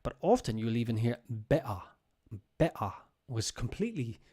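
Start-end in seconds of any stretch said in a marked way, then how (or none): 1.3-1.8 "better" as "be'ah", glottal stop for the t, no r; London "better" with the middle t dropped; swallowed T. Shown as a demonstration. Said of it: "The T is swallowed". 2.6-2.9 "better" as "be'ah", glottal stop for the t, no r; London "better" with the middle t dropped; swallowed T. Shown as a demonstration. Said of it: "The T is swallowed".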